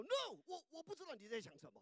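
high, fast flustered denial; panicked denial. Retold or imitated. Peter panics denying the servant girl, high and fast. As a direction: deny it in a rush. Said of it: "Peter freaked out".

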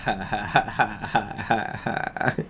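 soft, long villain chuckle; quiet staccato mean snicker; mean laugh. A narrator's reading. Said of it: "Snoop laughed meanly".

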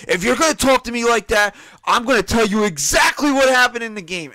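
acted-out scolding, loud and high; angry retort. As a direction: fire back a loud threat. Acts wife's angry threat.

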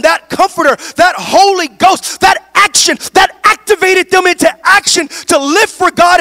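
high, loud climactic preaching; preaching at full force. Acted. Pastor proclaiming the Spirit falling at Pentecost, full-force preaching. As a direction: build to a shouting climax.

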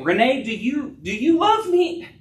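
husband pressing his wife, pitched high and urgent; pleading question. Acted. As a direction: press her for an answer, rising high. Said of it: "That's what Jesus was doing".

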